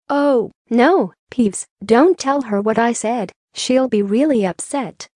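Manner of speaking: ordinary delivery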